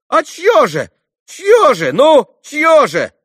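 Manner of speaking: very high, loud, insistent questioning; agitated demand. A narrator's reading. Townsman pressing the boy for whose business, agitated shouting. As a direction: shout for an answer, worked up.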